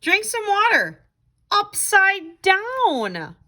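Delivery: ghost giving advice. Acted. Ghost tells Skeleton how to stop hiccups, lightly voiced. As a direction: offer it like a helpful little ghost.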